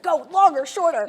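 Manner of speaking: loud, high-pitched bossy calls; mock shouted orders. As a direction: imitate someone shouting out directions. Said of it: "literally monitoring it for me".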